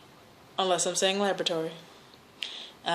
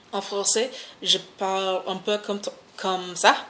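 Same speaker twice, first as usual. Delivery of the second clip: halting French with an American accent; speaking French. Shown as a demonstration. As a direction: stumble through rusty French, searching for words. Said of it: "as far as speaking another language".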